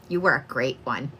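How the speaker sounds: low, soft quote with a little grin; grinning grandmother. Retold or imitated. Reader relaying grandmother's praise to her grandchild, grinning softly. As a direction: imitate her pleased, quiet verdict. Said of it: "she kinda grinned a little bit".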